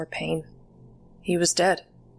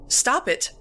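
alarmed cry; 6 semitones higher than usual. A narrator's reading. Girl to grandpa touching her, alarmed cry. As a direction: pitch leaps up in protest. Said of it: "I cried".

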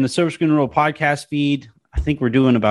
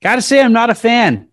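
much higher and louder blunt verdict; blunt Uber driver; 11 semitones higher, 5 dB louder than usual. Retold or imitated. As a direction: deliver the driver's honest jab, pitched up.

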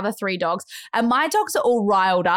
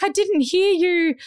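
high, slow protesting voice; voiced mum's excuse; 8 semitones higher than usual. Mum excusing herself to daughter, high protest. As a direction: play mum protesting, pitched up.

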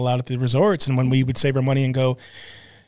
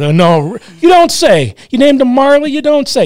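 loud, high sarcastic mock; 10 semitones higher, 9 dB louder than usual. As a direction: scoff it out, mocking and much louder. Mocking buddy naming dog Marley.

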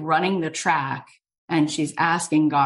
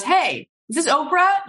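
high, loud hammed-up phone greeting; bursting onto the line, pitch leaping up; mock booming caller, pitched high; Steven Spielberg; 13 semitones higher, 4 dB louder than usual. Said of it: "it's Steven Spielberg".

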